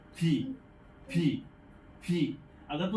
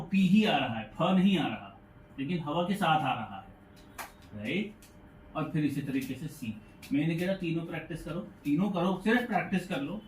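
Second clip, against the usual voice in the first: normal talking voice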